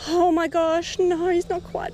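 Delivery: ordinary talk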